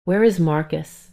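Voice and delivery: ordinary delivery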